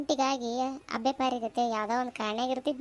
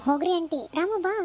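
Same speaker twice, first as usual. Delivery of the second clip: higher, louder embarrassed brush-off; bashful; 5 semitones higher, 3 dB louder than usual. Acted. Teased girl brushes off aunty and calls Ramu, bashful. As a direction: shoo the teasing away shyly. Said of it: "ಈಗ ನಾಚ್ಕೊಂಡ್ರೆ ಎದಕ್ ಬರುತ್ತಪ್ಪ".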